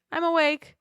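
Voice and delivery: high-pitched call to the next room. Retold or imitated. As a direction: call brightly through to someone next door. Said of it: "I call into the next room".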